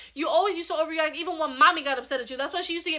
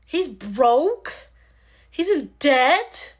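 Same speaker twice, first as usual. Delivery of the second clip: shocked; 5 dB louder, slower than usual. Acted. Reveals uncle's debt.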